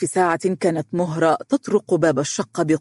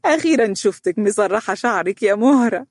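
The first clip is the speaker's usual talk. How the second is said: higher, teasing amusement; amused; 5 semitones higher than usual. A narrator's reading. Mother teasing the girl about combed hair, amused. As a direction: say it just after a chuckle. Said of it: "ضحك فارس ووالدته التي قالت".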